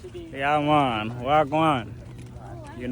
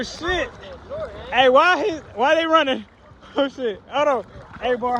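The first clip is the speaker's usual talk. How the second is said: ordinary talk, nothing marked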